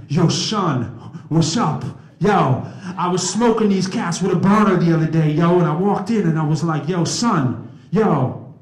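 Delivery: loud, hammed-up street swagger; street hip-hop talk. Speaker showing how he won't greet the president, street hip-hop talk. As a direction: put on a brash rapper's street voice. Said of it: "I'm not gonna be like".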